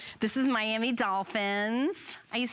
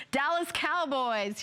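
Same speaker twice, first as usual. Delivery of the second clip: slow, higher, put-on Texas drawl; Dallas accent; 4 semitones higher, slower than usual. Shown as a demonstration. Host trying each team's accent, in a drawn-out Texas accent. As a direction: stretch it out in a playful Dallas accent. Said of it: "I'm gonna try to do the accent for each team".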